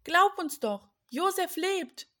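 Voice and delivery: high, urgent plea to be believed; insistent call. A narrator's reading. Brothers urging Jakob to believe them, insistent calling. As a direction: insist loudly, drawing out the good news. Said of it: "riefen die Brüder".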